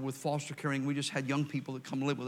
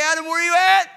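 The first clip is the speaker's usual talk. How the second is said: loud, high call, holding the last word at; God calling out; 14 semitones higher, 14 dB louder, faster than usual. Retold or imitated. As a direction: shout for Adam across the garden. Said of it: "why did he call out and say".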